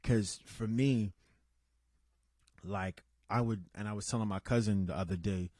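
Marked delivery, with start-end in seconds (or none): none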